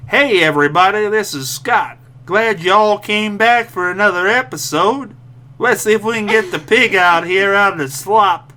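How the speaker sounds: slow hammed-up Alabama accent; lightly put-on Alabama twang, drawn out; mock Alabama accent. Host hosts the podcast in a slow mock Alabama accent. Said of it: "That's how they talk down in Alabama".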